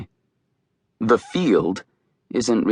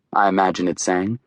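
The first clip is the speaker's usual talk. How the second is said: ordinary delivery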